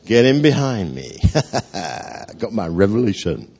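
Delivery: cocky, with a smug chuckle; puffed-up boasting. Acted. Simon struts before the other disciples, boastful. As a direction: lord it over your mates. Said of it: "Puffing his chest out".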